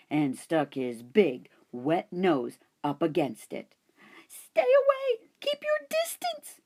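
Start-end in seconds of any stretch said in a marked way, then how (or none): 4.3-6.6 much higher, tiny frantic squeak; squeaky hamster voice. Acted. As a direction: squeak it out like a scared little hamster. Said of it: "I squeaked".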